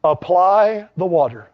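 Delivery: ordinary talk, nothing marked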